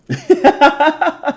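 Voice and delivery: high staccato cackle; character laugh. Acted. Streamer performing anime voices, high villain cackle.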